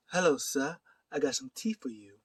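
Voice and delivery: put-on British accent. Joking offer of tea, put-on British accent. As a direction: do a playful mock British accent. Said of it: "that was my British accent".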